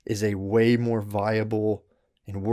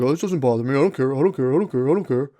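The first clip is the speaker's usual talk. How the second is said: louder, insistently brushing it off; mock dismissive denial; 2 semitones higher, 5 dB louder, faster than usual. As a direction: repeat "I don't care" like a stubborn refusal. Mocking denial of feelings.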